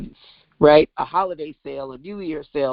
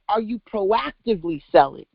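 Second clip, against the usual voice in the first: ordinary delivery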